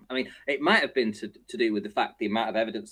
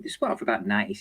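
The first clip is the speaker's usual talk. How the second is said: ordinary talk, nothing marked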